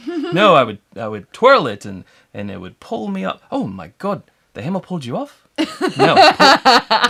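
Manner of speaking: amused two-character film dialogue in a put-on Antipodean accent; Korg. Host re-enacts the hammer exchange from the film, accented impression. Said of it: "I think it's Korg from".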